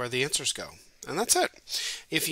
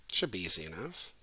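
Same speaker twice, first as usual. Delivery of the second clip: quietly muttered, low and soft; muttered aside; 6 semitones lower, 7 dB quieter than usual. Shown as a demonstration. Teacher mutters the usual line before a puzzle, softly. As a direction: mutter it under your breath. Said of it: "That's what you say before you give a puzzle".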